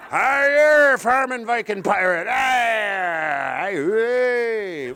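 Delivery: slow, loud Norfolk pirate-farmer; mock Norfolk accent. Comic showing Norfolk sounds the same, loud drawn-out pirate-farmer accent. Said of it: "you're in Norfolk, they sound the same".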